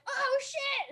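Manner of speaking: high-pitched mock gasp of recognition; excited reaction. People hearing about the weather job, mock excited.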